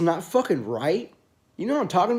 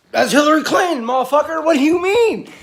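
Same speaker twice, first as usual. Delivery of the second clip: mock outraged yell; 10 semitones higher, 7 dB louder than usual. Mocking a friend's betrayal.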